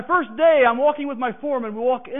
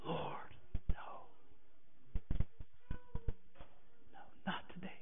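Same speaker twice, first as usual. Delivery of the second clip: barely voiced, trembling plea; fearful whispered prayer; 10 semitones higher, 18 dB quieter than usual. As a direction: whisper it in dread, begging God. Fearful prayer facing hated man.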